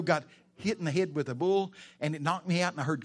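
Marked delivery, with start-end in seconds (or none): none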